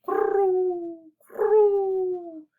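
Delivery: high, long dove coo. A narrator's reading. Reader voicing doves for children, cooing. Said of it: "The doves cooed".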